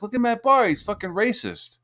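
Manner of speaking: high-pitched mock alarm; mock accusation. Accuser showing a screenshot to the group, mock outrage.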